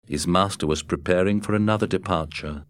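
plain reading voice

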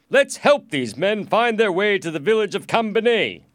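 high, loud call to servants; loud lordly order; commanding lord. A narrator's reading. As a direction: proclaim it grandly to your men. Lord calls to his servants.

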